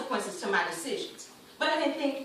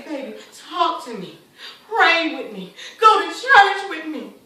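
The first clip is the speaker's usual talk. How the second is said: loud, imploring mother; impassioned, insistent appeal; urgent pleading; 7 dB louder than usual. Acted. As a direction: beg your child to come along. Mother begging her daughter.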